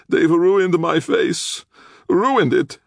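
slow, very high wail; moaning. A narrator's reading. Injured man with broken nose complains, high wailing moan. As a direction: moan it loudly and drawn out. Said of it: "Castiglione moaned".

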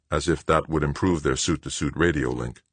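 ordinary delivery, nothing marked

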